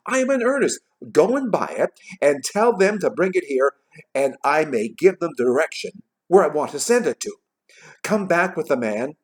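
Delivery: earnest Scrooge. A narrator's reading. Scrooge sending the boy for turkey, earnest. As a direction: insist eagerly, punching key words.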